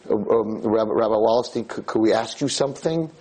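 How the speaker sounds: ordinary talk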